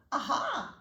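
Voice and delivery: high chirpy little bird voice; sing-song bird. A narrator's reading. Bird teacher greeting her first student, high and sing-song. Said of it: "she sang".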